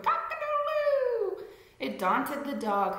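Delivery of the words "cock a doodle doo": very high rooster crow. Acted. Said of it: "who crowed".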